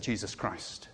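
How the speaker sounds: a reverent hush, low and slow; hushed reveal. Acted. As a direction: drop the voice to near a whisper for the name. Hushed reveal of the divine name.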